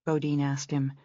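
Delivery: plain reading voice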